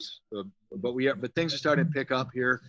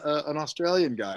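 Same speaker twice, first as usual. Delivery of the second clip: ordinary talk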